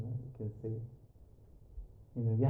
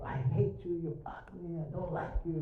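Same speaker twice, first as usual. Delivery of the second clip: higher-pitched, hammed-up hate; mock hateful; 5 semitones higher, 5 dB quieter than usual. Speaker imitating someone insulting the water, mock hateful. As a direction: act out hurling insults, pitched up. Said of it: "like, really hateful".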